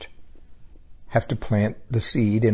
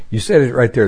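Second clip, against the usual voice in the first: ordinary delivery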